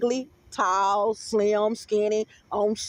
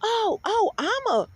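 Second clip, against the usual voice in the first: high put-on voice; 7 semitones higher, slower than usual. Quoting a smart person's voice.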